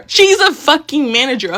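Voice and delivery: indignant exclamation; outraged reveal. Retold or imitated. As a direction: burst out with the outrage.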